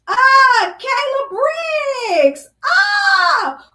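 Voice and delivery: high, loud delighted shout of a name; excited greeting. Real, not acted. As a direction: call out the viewer's name with joy. Greets viewer joining the stream.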